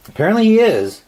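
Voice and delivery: high, loud mock amazement; amazed. Real, not acted. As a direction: act stunned by the score.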